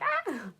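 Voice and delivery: a short, very high, loud "Ah!" of delight; excited squeal. Real, not acted. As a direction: squeal it out like you just read a lovely comment. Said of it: "I just get really excited about it".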